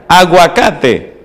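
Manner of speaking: Aguacate drawn out very slowly, relishing it; savoured word. Acted. Pastor savouring the word he loves, drawn out slowly. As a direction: let "Aguacate" linger slowly with delight.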